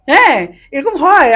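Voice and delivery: laughing it off, slowly; amused laugh while admitting it. Real, not acted. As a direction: chuckle through the words. Laughing about being scolded.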